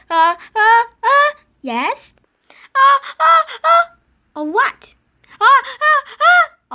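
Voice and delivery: loud high uh uh before sneezing; gasping toward a sneeze; sneeze build-up. Acted.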